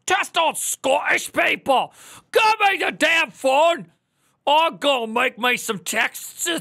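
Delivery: loud, high, slow mock Scottish bellow; hammed-up Scottish yell, demanding the phone; Scottish accent. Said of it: "My Scottish accent".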